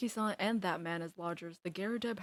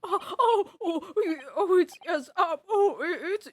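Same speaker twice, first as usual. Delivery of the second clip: high, frantic, stammering alarm; panicked character voice; 8 semitones higher, 7 dB louder than usual. Acted. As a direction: sputter in shocked panic.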